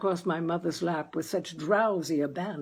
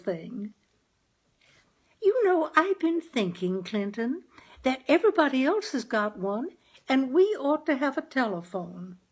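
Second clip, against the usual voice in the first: higher, sweet-talking wife voice; coaxing mother; 6 semitones higher than usual. Acted. Wife coaxing her husband Clinton toward a telephone, higher and sweet. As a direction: wheedle your husband gently.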